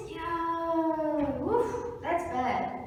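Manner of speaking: reluctant ox. Acted. Ox hears the mouse's plea, drawn-out and reluctant. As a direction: stretch the first word in doubtful sympathy.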